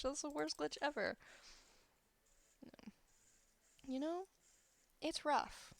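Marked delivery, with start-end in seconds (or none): none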